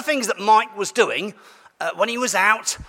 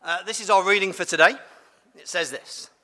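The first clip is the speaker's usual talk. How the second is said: ordinary talk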